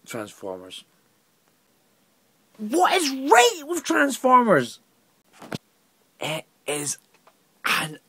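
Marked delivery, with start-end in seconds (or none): 2.6-4.8 high, drawn-out incredulous question; slow, loud squeak of disbelief. Real, not acted. Film reviewer turning his own question round, incredulous.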